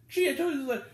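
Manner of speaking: a mock prim lady; mocking complainer. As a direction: sneer it at her partner. Quoting the complaining woman.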